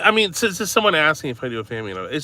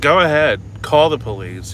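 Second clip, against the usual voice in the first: louder, defiant mock snap; retold angry retort; 5 semitones higher, 6 dB louder than usual. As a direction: dare him, hammed-up anger. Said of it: "I said".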